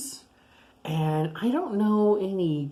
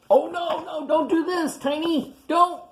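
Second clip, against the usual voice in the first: high, loud alarmed plea; alarmed cry; 12 semitones higher, 4 dB louder than usual. Acted. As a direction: cry out to stop him in a panic. Narrator alarmed at Tiny hugging cactus.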